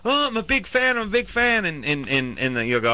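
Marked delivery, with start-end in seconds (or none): 0.1-1.6 much higher, gushing fan voice; mock gushing fan. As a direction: play the eager fan, high and breathy-free. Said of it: "They'll go".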